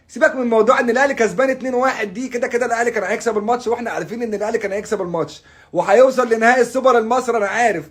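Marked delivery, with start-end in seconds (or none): none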